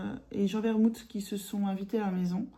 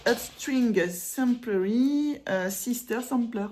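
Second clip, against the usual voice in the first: slow, higher, exaggerated English accent; self-mocked English accent; 4 semitones higher, 3 dB louder, much slower than usual. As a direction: drag out the English names in a hammed-up English accent. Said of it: "vous allez entendre mon accent, un peu dingo".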